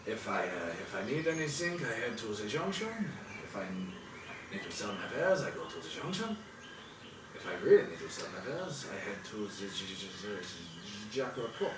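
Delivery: put-on lightly accented, hesitant old fisherman. Old fisherman tells travellers where he trades, halting and mild. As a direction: ramble haltingly as a humble river trader.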